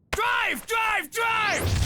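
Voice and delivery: high, panicked shouts; frantic yelling. Acted. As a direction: scream it at the wheel. Yelling during getaway.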